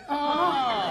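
high, loud, drawn-out "aah". Acted. Patient overcome by the racy novel, loud high cry.

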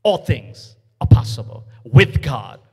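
loud preacher's proclamation; preaching. Acted. Preacher booming to the church, full force. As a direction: proclaim it to the congregation.

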